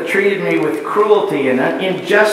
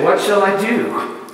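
prodigal son's question. Retold or imitated. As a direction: ask it like a stranded, hungry kid. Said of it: "The kid in the pig pen asked it".

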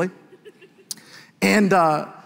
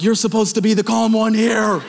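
higher, agitated protest for laughs; mock freak-out; 3 semitones higher than usual. Dad to the gasping emergency doctor, mock-panicked. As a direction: retell a dad's rising panic. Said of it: "I'm freaking out internally too".

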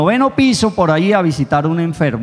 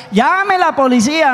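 calling for police; 11 semitones higher than usual. Acted.

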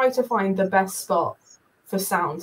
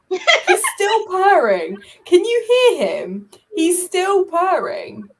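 high, slow, laughing coo at the cat; high doting laugh-voice; laughing delight; 9 semitones higher, 7 dB louder, slower than usual. Real, not acted. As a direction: gush over the purring cat through laughter.

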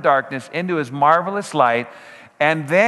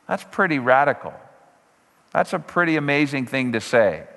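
ordinary talk, nothing marked

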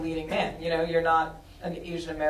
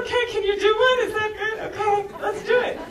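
high, laughing, bubbly retelling of her own yes; giddy retold reply; 7 dB louder than usual. Director recalling saying yes to the actor, high and giggly. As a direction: squeal the go-ahead through laughter.

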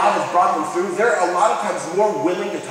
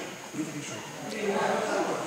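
much softer, near-silent mouthed question; hushed mouthing; 3 semitones lower, 11 dB quieter than usual. Shown as a demonstration. Friend mouthing a warning from the back row, hushed. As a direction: mouth it as if signalling across a room. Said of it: "I see my friend Jeremy going like this".

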